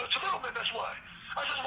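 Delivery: ordinary talk, nothing marked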